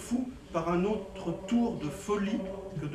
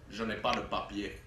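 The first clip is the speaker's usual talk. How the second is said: put-on low, strong African accent on a sans-papiers; imitating a strong African accent, low and soft; 9 semitones lower, 8 dB quieter than usual. Sans-papiers answering the policeman in a strong African accent. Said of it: "A-t-il rétorqué avec un fort accent africain".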